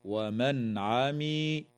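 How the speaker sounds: ومن عمي with a clear N before the ع; clear nun. Shown as a demonstration. Teacher showing izhar, slow careful recitation. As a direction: pronounce the N distinctly, then the ع. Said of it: "تظهر النون الساكنة عند العين".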